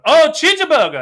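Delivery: put-on loud, high burst of Korean-accented recognition; exclaimed chee-juh-buh-guh, Korean accent. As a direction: imitate sudden understanding in a Korean accent. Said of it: "with a Korean accent".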